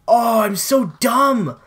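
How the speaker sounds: slow, loud, high cry of self-disgust; frustrated outburst. Real, not acted. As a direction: groan it out after dying. Just died in the match.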